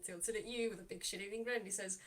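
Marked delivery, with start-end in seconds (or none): none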